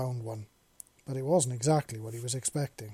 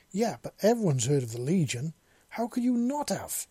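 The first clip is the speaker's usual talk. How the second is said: loud, higher disbelieving yell; 5 semitones higher, 5 dB louder than usual. A narrator's reading. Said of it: "the man yelled".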